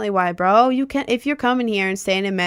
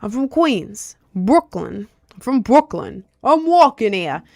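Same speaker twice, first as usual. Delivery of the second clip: badly faked New York accent; 3 semitones higher than usual. Trying out borough names, badly faked New York accent. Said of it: "I'm really bad at the accent".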